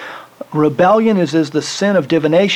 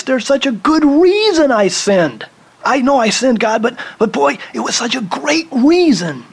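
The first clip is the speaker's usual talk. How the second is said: higher, pleading self-justifying voice; whiny excuse; 7 semitones higher than usual. Acted. Preacher voicing Saul justifying his sin to God, higher pleading whine. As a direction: beg God to accept the good reason.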